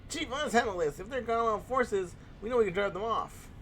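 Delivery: put-on high whiny young hero voice. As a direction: whine it like a petulant hero. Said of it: "I give him the whiny voice".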